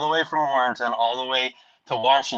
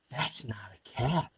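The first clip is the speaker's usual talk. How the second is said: slow, hushed disbelief; imitated quiet double take; retold dawning realisation; 8 dB quieter than usual. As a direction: say it slowly, much softer.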